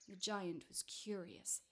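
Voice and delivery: usual speaking voice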